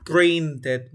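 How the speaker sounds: loud "Printed" in two syllables, print-ed; ed pronounced fully. Shown as a demonstration. Teacher confirming the student's answer, loud and clearly sounded ed. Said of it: "Como ed. ¿Cómo sería?".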